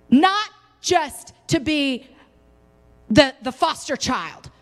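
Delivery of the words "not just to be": ordinary talk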